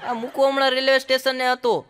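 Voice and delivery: ordinary talk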